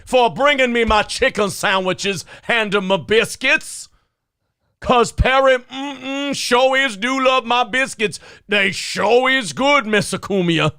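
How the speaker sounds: high, loud, exaggerated mock-servant voice, pitched well up; Perry's caricature voice. Reenacting the Perry bit for the stream, high loud exaggerated caricature voice. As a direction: ham it up as an over-the-top fawning caricature, high and loud. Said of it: "'Cause Perry".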